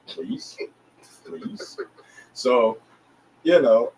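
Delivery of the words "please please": unvoiced, soft begging please; whispered pleading. Asking his partner for his own room, whispered plea. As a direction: beg it under your breath.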